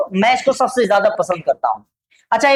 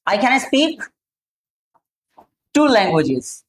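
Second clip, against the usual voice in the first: slow, word-by-word English answer; slow model answer; much slower than usual. Shown as a demonstration. Teacher modelling an answer slowly for students. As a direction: say it slowly, one word at a time. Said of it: "या".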